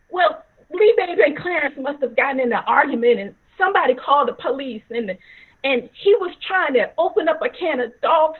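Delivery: loud, heated account; agitated explaining. Retold or imitated. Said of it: "my dad was yelling".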